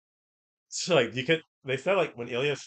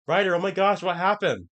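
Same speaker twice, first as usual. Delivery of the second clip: high, loud shocked call; louder, higher panicked question; alarmed cry; 7 semitones higher, 8 dB louder than usual. Retold or imitated. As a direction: shout it in alarm. Acted alarmed quote.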